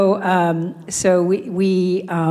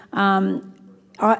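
ordinary talk

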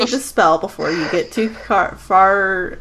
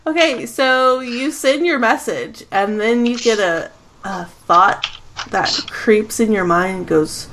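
usual speaking voice

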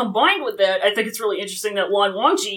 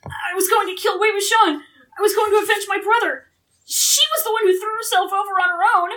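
high, hammed-up tearful self-defence; whimpering excuses; 8 semitones higher than usual. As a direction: blubber excuses like a panicked kid. Acting the panicked kid's excuses.

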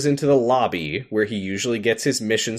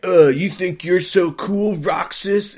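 higher, louder taunting sneer; mocking challenge; 8 semitones higher, 4 dB louder, slower than usual. Hayner challenging Roxas, a mock taunting sneer. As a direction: needle him like a cocky rival. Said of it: "So Hayner is like".

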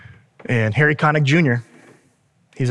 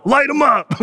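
mock shouted order; 9 semitones higher than usual. Soldier in the movie orders firing on the alien, mock shout. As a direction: yell it like a soldier giving the order.

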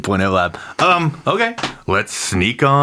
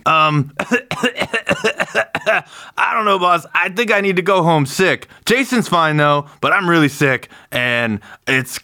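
higher, gravelly fake-sick guard voice; gravelly Wyatt impression; 7 semitones higher than usual. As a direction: pitch it up, gravelly, playing sick. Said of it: "just that gravelly Wyatt impression worked".

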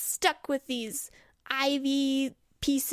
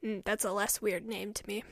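low quiet man voice; 7 semitones lower, 8 dB quieter than usual. Acted. Forest man.

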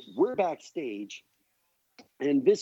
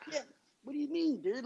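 feigned innocence; 11 semitones higher, 8 dB quieter than usual. Retold or imitated. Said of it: "And I go".

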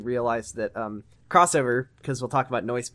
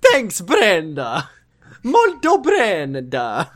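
high, loud, slowly stretched sign-off; hammed-up shout; 7 semitones higher, 8 dB louder, much slower than usual. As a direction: belt it out with long drawn vowels. Drawn-out silly shouted sign-off.

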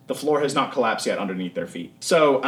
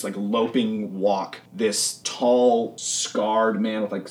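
usual speaking voice

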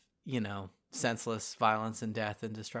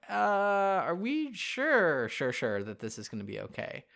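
put-on slow, higher, unsure girl voice; hesitant girl voice; 6 semitones higher, slower than usual. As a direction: drag out the doubt like a nervous kid. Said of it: "Uran's like".

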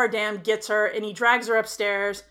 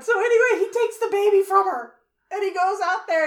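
high, loud, worked-up storytelling; racing higher and louder through the retelling; agitated retelling; 9 semitones higher, 5 dB louder than usual. Real, not acted. Telling the friend the plot, higher and louder with rising alarm. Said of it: "Oh, God".

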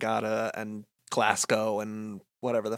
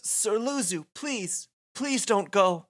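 put-on pleading girl; 9 semitones higher, slower than usual. Yuna begging Luzu not to go to battle, high pleading voice. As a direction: beg him not to leave.

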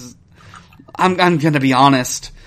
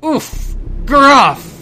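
oof and grunt; 11 semitones higher, 6 dB louder than usual. Acted. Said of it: "it goes".

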